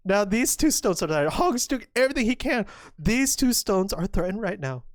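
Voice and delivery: usual speaking voice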